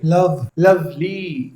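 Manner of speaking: very slow "love, lovely" with weight on "love"; stress on LOVE. Shown as a demonstration. Teacher splits the word for students, stress on first syllable of "lovely". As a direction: draw out "love" and let the "ly" of "lovely" fall away. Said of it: "लव पे यहाँ पे stress है".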